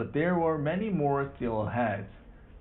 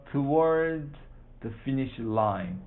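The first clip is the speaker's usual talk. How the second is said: usual speaking voice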